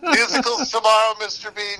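lightly voiced Mary Poppins, high and bright-toned; a hint of a brisk nanny announcing plans; Mary Poppins manner. Telling the young fan about tomorrow's musicals, in a light Mary Poppins voice. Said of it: "a little bit of Mary Poppins when I said".

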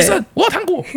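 very high, resolute child impression; determined little kid. Kid firmly asks the doctor for candy, high mimicked voice. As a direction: pipe up like a small, unwavering child. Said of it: "非常坚定".

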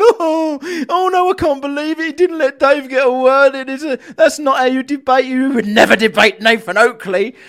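high-pitched imitation of complaining viewers; mock outrage. Imitating people defending a debater, mock outrage. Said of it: "and people are going".

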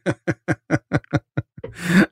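bursts into a high laugh. Real, not acted. Laughs at partner's joke.

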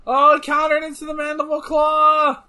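high, loud, sustained match call; excited commentator shout. Real, not acted. Fan calling a surprise finisher, excited shout. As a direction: shout the big counter like a ringside announcer.

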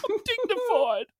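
very high, whiny put-on voice; hammed-up tearful protest; mock distressed wail. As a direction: whine helplessly in a squeaky pitch. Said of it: "Just like".

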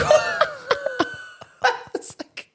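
high, slow laugh. Real, not acted. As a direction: laugh through the words. Laughs while retelling the exchange.

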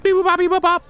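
high-pitched imitated keypad tones; quick mock touch-tone dialling; phone dialling beeps. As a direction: sing out little electronic phone beeps.

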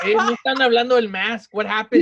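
loud, high mock-outraged listener; complaining listener. Listener who tuned in for the mask, mock outrage. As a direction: act out an indignant fan protesting. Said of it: "You're like".

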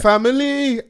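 Nigerian accent. Shown as a demonstration. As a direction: Nigerian accent on "family" as "fæmɪli". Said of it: "Oh, we say family".

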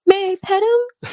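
a hint of a sweet small boy asking nicely; polite little kid. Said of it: "He's like".

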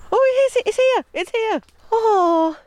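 high, loud thrilled cry of discovery; excited. Real, not acted. Finding the dog's grave at last, thrilled. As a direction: burst out, delighted to find it.

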